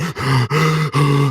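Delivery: long startled gasp in; stunned gasp. Retold or imitated. Brother after dad's intervention, long stunned gasp. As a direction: gasp like the rage just drained. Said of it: "He's like".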